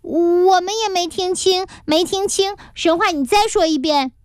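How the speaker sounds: put-on high voices of protesting kids; protesting children. A narrator's reading. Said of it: "还有好几位同学一起抗议".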